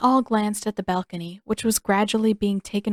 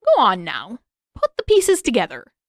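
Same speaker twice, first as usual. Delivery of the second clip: cackling king; 3 semitones higher, 7 dB louder than usual. A narrator's reading. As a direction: egg him on through a cackle. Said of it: "with a cackle".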